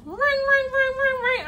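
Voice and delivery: high, rapid chain of rings; imitated phone ring. Getting a call at home, high mimicked ringing. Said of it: "I get a call".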